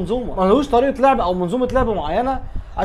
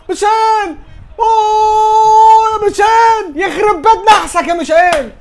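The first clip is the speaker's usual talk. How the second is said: high, loud frustrated yell; frustrated shout; 9 semitones higher, 5 dB louder, much slower than usual. Real, not acted. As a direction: yell at the player for missing. Missed chance by Michel.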